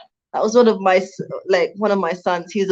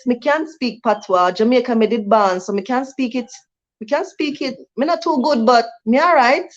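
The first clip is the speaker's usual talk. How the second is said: Jamaican patois, pitch lifted higher; 3 semitones higher than usual. Shown as a demonstration. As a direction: show off a bit of Jamaican patois, playful. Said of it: "so that's a bit of patois from me".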